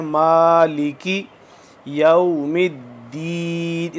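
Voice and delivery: मालिकी with a long मा, slow; lengthened vowel. Shown as a demonstration. Teacher recites मालिकी for students, drawing out the मा. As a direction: stretch the मा of मालिकी. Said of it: "एक अलिफ के बराबर लम्बा करना है".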